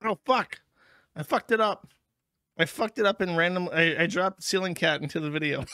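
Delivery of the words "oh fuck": ordinary talk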